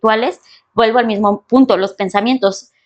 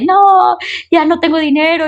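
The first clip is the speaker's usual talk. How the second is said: mock complaint; 6 semitones higher than usual. Voicing people who can't stand their situation, mock high protest. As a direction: imitate someone wailing over their troubles.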